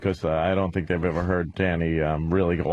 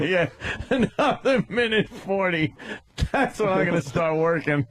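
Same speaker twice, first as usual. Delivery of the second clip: laughing; 11 semitones higher than usual. Real, not acted. Joking about when they'll start working, high and laughing. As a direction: laugh it out, pitched well up.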